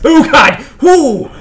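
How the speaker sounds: high, loud cry of alarm; startled yell. Real, not acted. Player passed suddenly on the road, startled yell. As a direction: yelp in shock, then whoop.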